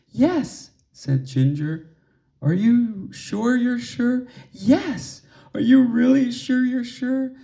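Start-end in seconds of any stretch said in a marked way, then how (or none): 0.1-0.7 put-on much higher, a timid little girl; tiny voice saying yes; higher small voice for a shy flower girl; small girl's voice. A narrator's reading. 2.4-7.4 put-on insistent child. A narrator's reading. Lily pressing Ginger repeatedly.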